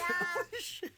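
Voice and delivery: shocked laugh. Real, not acted.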